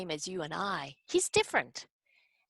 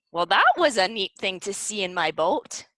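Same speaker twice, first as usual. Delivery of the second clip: ordinary talk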